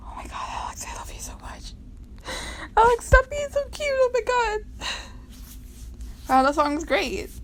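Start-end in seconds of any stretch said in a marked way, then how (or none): 0.1-1.7 breathless gushing whisper. Real, not acted. Reading a sweet comic, whispering adoringly. Fangirling over comic character's cuteness. 2.8-4.9 high squeal over something adorable. Real, not acted. Reader squealing at a cute comic panel.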